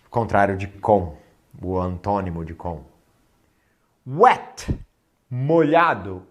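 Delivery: usual speaking voice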